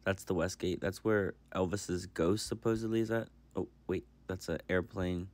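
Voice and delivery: soft, calm recorded voiceover; voiceover narration. Real, not acted. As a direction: narrate quietly over the footage. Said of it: "it is voiceover time".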